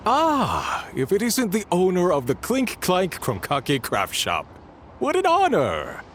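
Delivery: high, gushing greeting; fawning welcome. Acted. Clerk gushing over the shop owner's visit. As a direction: flatter the famous guest.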